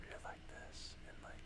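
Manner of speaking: hushed whisper imitating an ASMR barber; whispered ASMR talk. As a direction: drop into a soft, voiceless whisper. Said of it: "he was just, like, talking".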